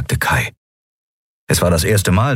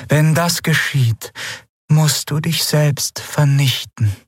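higher, slow, heavy-hearted; grave command; 9 semitones higher, slower than usual. Acted. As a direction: give the order with sorrow. (schwerer, trauriger Befehl)

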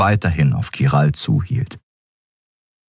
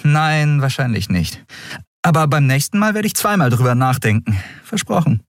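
higher, hesitant question; Endriel, higher voice; 6 semitones higher than usual. A narrator's reading. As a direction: ask carefully, in a higher voice. Endriel, winking. (Endriel fragt Kero zögernd, höhere Stimme)

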